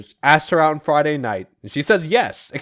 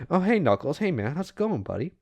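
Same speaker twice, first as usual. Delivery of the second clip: softer, casual mock greeting; nonchalant; 6 dB quieter than usual. Vector greets Knuckles after badmouthing him, feigned nonchalance. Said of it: "nonchalantly goes".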